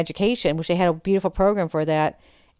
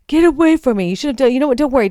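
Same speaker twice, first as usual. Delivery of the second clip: imitating a higher, louder angry outburst; angry shout; 7 semitones higher, 5 dB louder, faster than usual. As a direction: hurl it at her like a man losing his temper. Harry snapping at her.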